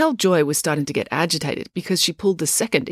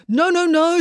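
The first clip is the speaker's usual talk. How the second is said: high, louder anxious denial; anxious placating; 14 semitones higher, 5 dB louder, slower than usual. Acted. Joe heading off April's challenge, anxious. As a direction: rush to smooth things over, high-pitched. Joe hurriedly heads off a fight.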